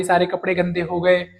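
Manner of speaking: ordinary talk, nothing marked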